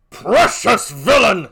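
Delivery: loud, high curse; loud rage; furious cry. Acted. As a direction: snarl it at him. Othello rages at Iago.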